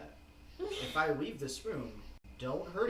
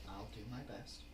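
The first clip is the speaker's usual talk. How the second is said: softer, gentle reassuring voice; softer gentle promise; gentle reassurance; 6 semitones lower, 12 dB quieter than usual. Acted. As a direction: reassure her quietly. GM voicing Mister Green gently.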